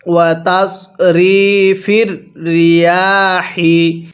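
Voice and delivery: slow chanted ওয়া তাসরিফির রিয়াহি with a light breathy হি; tajweed recitation. Shown as a demonstration. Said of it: "কিভাবে পড়ব?". (হালকা বাতাস দিয়ে তিলাওয়াতের সুরে)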